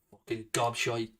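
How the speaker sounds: low, quiet contempt; muttered insult. Real, not acted. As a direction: mutter it under your breath, dropping low. Insult dropped after long angry rant.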